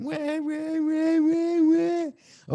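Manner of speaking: high, loud drawn-out wah-wah noise; mimicked producer nagging. Host mimics producer nagging in his earpiece, loud mocking wahs. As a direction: imitate a whining racket in your ear. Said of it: "He's in my ear going".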